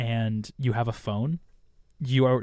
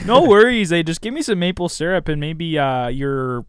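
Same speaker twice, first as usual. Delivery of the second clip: hammed-up Canadian accent; 2 semitones higher, 5 dB louder than usual. Mocking Canadians, loud.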